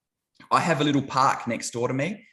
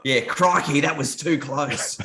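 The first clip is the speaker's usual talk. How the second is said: higher, louder hammed-up Aussie ocker accent, slow on the close; dumbed-down Aussie accent; 5 semitones higher, 3 dB louder, slower than usual. Australian mocking how American films voice Aussies, broad accent. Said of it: "that other accent is, is definitely sort of a bit of a dumbed down".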